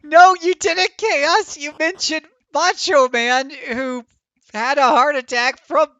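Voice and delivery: loud high accusing shout, slow on the middle; outraged yelling. Real, not acted. Streamer accusing Chaos over the heart attack, loud outraged shouting. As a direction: blame him at full volume.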